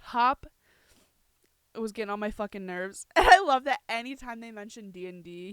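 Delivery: usual speaking voice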